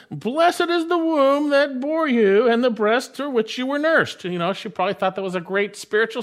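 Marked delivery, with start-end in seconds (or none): 0.1-4.2 high-pitched, lifted voice of a woman in a crowd; raised voice. A narrator's reading. Woman in the crowd calls out to the Lord, voice raised. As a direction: call it out high over a crowd. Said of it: "raised her voice".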